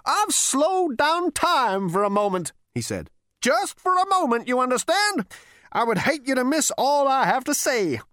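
put-on high, scornful old man; ghostly great-grandfather scolding; crotchety old ghost. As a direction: sneer down at the useless great-grandson. Ghost explains slowly.

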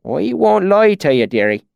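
high, broad country-dialect swineherd; rustic swineherd. A narrator's reading. Swineherd warning a traveller, in rustic dialect. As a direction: play a frank country pig farmer.

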